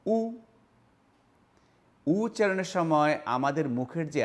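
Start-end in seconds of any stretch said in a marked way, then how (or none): 0.1-0.2 উ held with the lips rounded; vowel demo. Shown as a demonstration. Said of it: "উচ্চারণ হলো".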